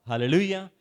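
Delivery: a loud ringing hallelujah; shouted hallelujah. Real, not acted. Preacher shouting praise to the congregation, loud call. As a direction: call it out to the church.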